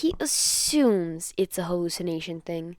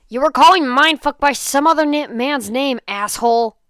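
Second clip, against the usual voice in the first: much louder, higher furious shout; angry yell; 6 semitones higher, 14 dB louder than usual. Acted. Katsuki yells at Denki for the wrong name. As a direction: bark it at him like Katsuki.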